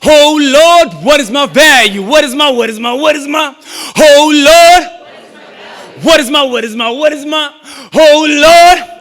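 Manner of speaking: rhythmic, rising poetry performance; spoken word with a chanted refrain; spoken word poem. Acted. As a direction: build it like a sermon in verse.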